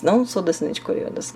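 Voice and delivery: normal talking voice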